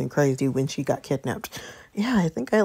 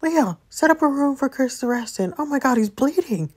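alarmed; 8 semitones higher than usual. Acted. Finding beaten friend bleeding.

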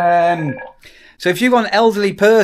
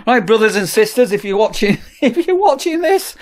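greeting viewers while laughing, higher pitch; 5 semitones higher than usual. Shown as a demonstration. Testing "brothers and sisters" on viewers, laughing through it. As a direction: try out the new greeting and crack up. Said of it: "should I start saying".

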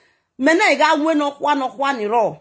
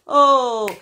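ordinary talk, nothing marked

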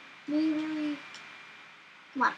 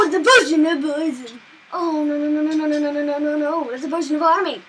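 panicked; 6 dB louder, faster than usual. Real, not acted. Hit by witch's potions.

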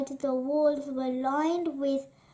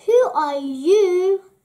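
loud, drawn-out, higher demand; startled cry; 5 semitones higher, 10 dB louder, slower than usual. A narrator's reading. Woken princess to a stranger, crying out loudly. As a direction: cry out loudly, stretching the words. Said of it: "she cried".

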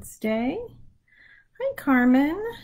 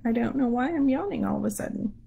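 ordinary talk, nothing marked

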